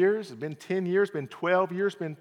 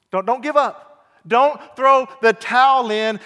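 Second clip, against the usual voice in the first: loud exhortation; 9 semitones higher, 9 dB louder than usual. Acted.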